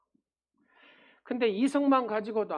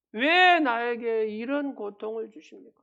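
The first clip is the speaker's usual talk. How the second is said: wailing outcry; 4 semitones higher, 8 dB louder than usual. Retold or imitated. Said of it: "하나님을 향해서 욕설을 퍼붓기도".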